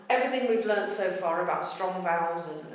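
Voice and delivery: normal talking voice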